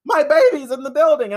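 put-on high, panicked cry about the baby; mock frantic mother; mock panicked woman. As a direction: shriek it like a frantic mother, half joking. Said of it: "a woman's like".

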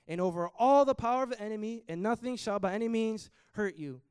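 loud, ringing scripture read; loud proclamation. Acted. Preacher reading the promise of protection aloud to the church, ringing. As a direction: declare it over the whole room.

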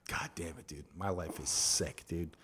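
soft unvoiced groggy whisper. Officer waking after a night with his mistress, whispered. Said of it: "officer waking up after night".

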